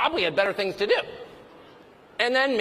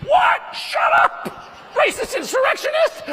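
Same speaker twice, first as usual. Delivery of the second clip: high-pitched hysterical accusation, hammed up; loud, very high mock fury; mock outraged shouting; 7 dB louder, slower than usual. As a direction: shriek it like a caricature of an outraged accuser. Mimics the outraged response to him.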